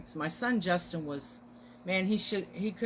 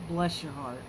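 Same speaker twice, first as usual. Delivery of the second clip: normal talking voice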